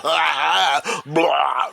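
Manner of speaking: exaggerated "ah, blah" of revulsion; hammed-up yuck noise; mock disgusted noise. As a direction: act out recoiling with a gagging cry. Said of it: "something that makes you go".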